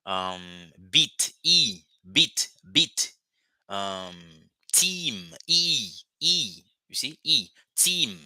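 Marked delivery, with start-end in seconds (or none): 0.9-3.1 "beat" with a long, stretched ee vowel; teacher's drawn-out ee. Shown as a demonstration. Teacher showing English learners the long ee in "beat". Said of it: "When you say". 4.7-8.2 "team" and "E" with a long, raised ee; teacher's drawn-out ee. Shown as a demonstration. Teacher drilling the long ee in "team" for students. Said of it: "The same sound".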